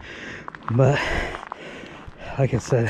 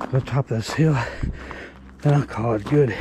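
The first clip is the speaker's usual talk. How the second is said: ordinary delivery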